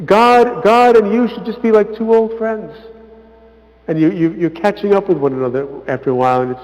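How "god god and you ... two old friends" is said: put-on higher, earnest quote of the old monk; quoting the elder. Elder telling monks about God, lightly voiced. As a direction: voice him fondly, pitched up. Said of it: "he'd say things".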